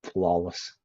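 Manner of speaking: very soft, self-satisfied; hushed smug boast. Real, not acted. Streamer praising his own Spanish, hushed smug boast. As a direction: murmur it like a private victory. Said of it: "Flawless, I tell you".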